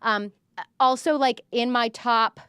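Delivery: ordinary talk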